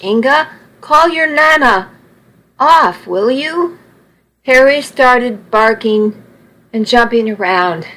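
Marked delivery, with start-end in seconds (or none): 0.0-3.8 pleading under attack. Acted. Charlie begs Inga for help.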